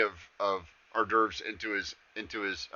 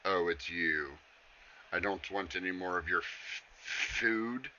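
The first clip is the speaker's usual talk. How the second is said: lower, softer disdainful tone; haughty Alice; 4 semitones lower, 9 dB quieter, slower than usual. Acted. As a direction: look down on the pest and dismiss him. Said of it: "she looks down at the satyr and goes".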